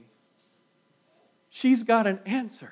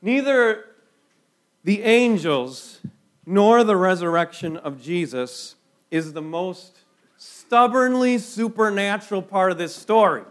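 ordinary talk, nothing marked

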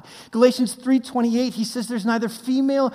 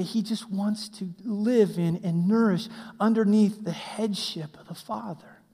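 ordinary talk, nothing marked

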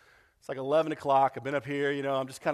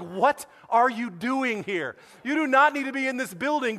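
retold scolding; 7 semitones higher, 6 dB louder than usual. Scolding pregnant volunteer at fire.

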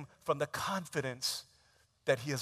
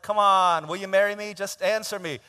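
loud, higher insistent pleading; pushy demand; 6 semitones higher, 11 dB louder than usual. Retold or imitated. Groom demanding an answer from his girlfriend, loud and pushy. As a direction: press her hard for an answer.

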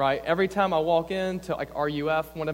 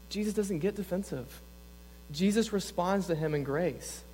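normal talking voice

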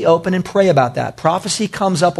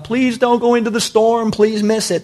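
higher-pitched mock plea; pleading; 5 semitones higher than usual. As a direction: beg him, in an imitated anxious voice. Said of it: "pleading with Paul".

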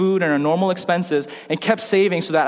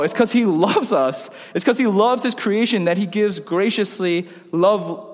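ordinary delivery

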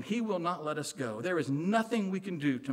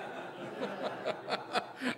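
a short breathless laugh; real laughter at his own joke; airy laugh; 9 semitones higher, 6 dB quieter than usual. Real, not acted. Preacher laughing at his own pig slop joke, airy laugh.

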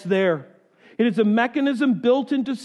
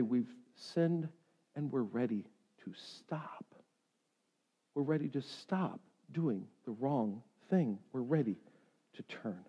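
very soft, low, near-whispered repentance; hushed confession; 7 semitones lower, 15 dB quieter than usual. Acted. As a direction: confess quietly, pausing between phrases. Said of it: "They needed to say".

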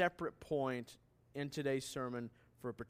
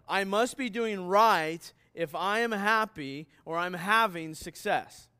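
louder, higher mock voice of a smug culture; 5 semitones higher, 8 dB louder than usual. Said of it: "that says this, listen, says".

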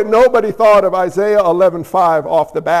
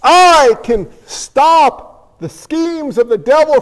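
"I can stop the schemes of the devil" slow, higher and louder; slow, emphatic; 6 semitones higher, 5 dB louder, much slower than usual. Shown as a demonstration. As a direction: proclaim it slowly, word by word. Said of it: "only if I finish that verse off".

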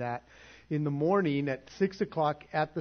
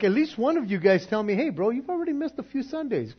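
exasperated plea; 9 semitones higher, 7 dB louder than usual. Retold or imitated. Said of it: "And it's like".

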